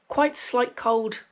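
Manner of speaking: normal talking voice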